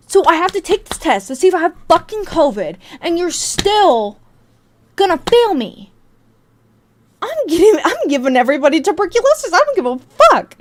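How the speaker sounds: high-pitched, exasperated outburst; loud, worked-up protest; indignant rant. Acted. Ranting at being failed while sick.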